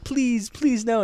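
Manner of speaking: high-pitched retold begging; imitated childish plea; pleading child. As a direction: beg like a frightened kid. Child begging mother in story.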